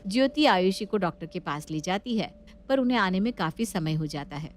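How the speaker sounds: plain reading voice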